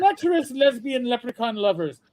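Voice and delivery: lilting put-on Irish announcement; Irish accent. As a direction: announce it with a comic Irish brogue. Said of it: "the Saint Patsy's one".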